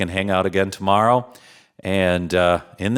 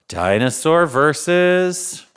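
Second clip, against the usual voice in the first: slow, drawn-out announcer call; slow showman announcing the next match; announcer build-up; 4 semitones higher, slower than usual. Acted. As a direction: stretch versus like a ring announcer.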